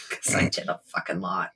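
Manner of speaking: low, soft, worn-out mutter; drained mumble. Real, not acted. Player exhausted after a big spell, low and soft. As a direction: sag into the words.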